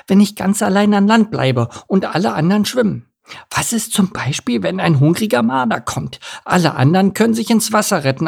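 high-voiced worried little duck; anxious duckling. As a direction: fret through the dangers as a young duckling.

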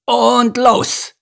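high, drawn-out starting call. A narrator's reading. Fat spider starting the race, calling out. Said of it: "rief die dicke Spinne".

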